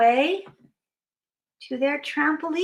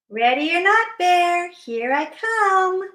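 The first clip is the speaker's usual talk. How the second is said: raised singsong call, stretched at the end; seeker's call; 5 semitones higher than usual. Acted. Seeker warns hidden Bear, singsong calling.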